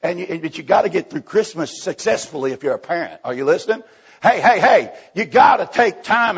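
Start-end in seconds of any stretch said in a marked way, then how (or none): 4.2-6.4 loud, higher call for attention. Real, not acted. Preacher calling out to parents about wrapping gifts, loud. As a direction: grab the room's ear.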